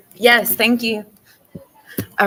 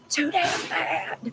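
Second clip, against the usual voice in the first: 1 grandpa voice; 8 semitones higher, 5 dB quieter, much slower than usual. Retold or imitated. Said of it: "the grandpa says".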